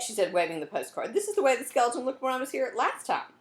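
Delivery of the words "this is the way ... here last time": higher, louder eager telling; still excited. Acted. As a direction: keep the thrill of the find going. Cam explains her discovery, still thrilled.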